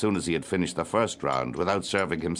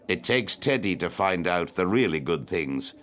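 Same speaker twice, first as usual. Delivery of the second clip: ordinary delivery, nothing marked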